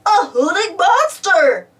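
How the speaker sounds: high, loud, drawn-out alarm; slow cartoon terror; cried in fright. A narrator's reading. Spud startled by an owl, frightened cry. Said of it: "Spud cried".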